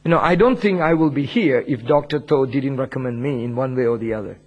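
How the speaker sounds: usual speaking voice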